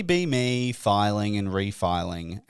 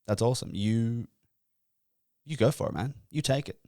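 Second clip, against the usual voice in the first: softer, lightly voiced encouraging listener; imagined supportive listener; 5 dB quieter than usual. Imagined listener urging the host to take the job, lightly voiced encouragement. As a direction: gently egg him on, as an imagined fan. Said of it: "now you're thinking".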